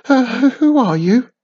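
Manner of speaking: high, loud startled question; nervous stammer. A narrator's reading. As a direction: ask timidly, ready to flee. Frightened witch faces giant face.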